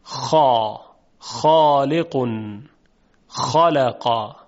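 slow, rough throaty خ in خالق, drawn out; Arabic kha sound. Shown as a demonstration. Tajwid teacher to students, slow demonstration of the rough kha. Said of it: "صدای حرف خا در زبان عربی قدری درشت هست".

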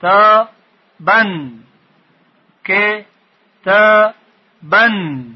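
teacher splitting the word for learners; syllabified slowly. Shown as a demonstration.

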